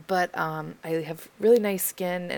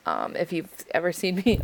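ordinary talk, nothing marked

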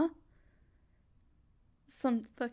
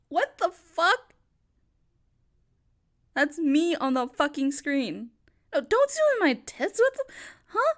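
outraged shout; 6 dB louder than usual. Acted. Woman seeing herself on the screen, shouting in horror. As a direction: shout in shock at what's on screen.

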